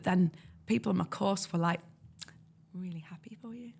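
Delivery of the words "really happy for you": tiny near-whisper, imitating begrudging classmates; hushed fake well-wishing; mock insincere congratulation. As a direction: barely squeeze the congratulations out. Coursemates' insincere congratulations, mimicked.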